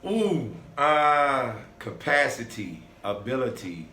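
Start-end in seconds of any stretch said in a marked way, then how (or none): none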